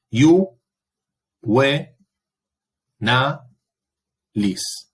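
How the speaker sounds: "iu, we, na, lis" as four isolated syllables, pausing after each; sílaba por sílaba. Shown as a demonstration. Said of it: "Separo iu". ("iu, we, na, lis" silabeado con pausas)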